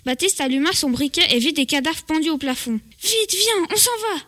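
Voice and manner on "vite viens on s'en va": panique. Acted. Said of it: "dit Nils en panique".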